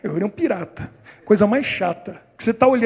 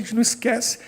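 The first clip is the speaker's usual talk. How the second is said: plain reading voice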